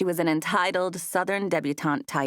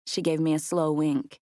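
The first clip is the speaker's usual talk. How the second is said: usual speaking voice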